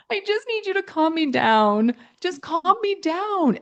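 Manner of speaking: put-on high, slow, tearful child's voice; pleading little girl. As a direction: plead like a small daughter begging to be calmed. Mother voices crying daughter's plea.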